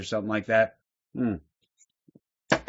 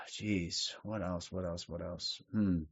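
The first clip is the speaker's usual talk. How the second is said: trailing mutter; 8 semitones lower, 15 dB quieter, slower than usual. Real, not acted. Host stalling, muttering to himself.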